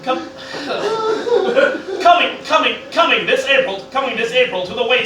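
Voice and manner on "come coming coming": ordinary talk, nothing marked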